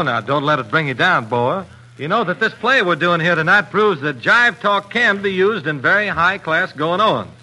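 ordinary talk

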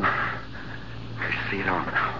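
breathy, slow whisper of horrible realization; stunned whisper. Acted. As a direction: whisper it slowly as the truth dawns. Dawning realization after her death.

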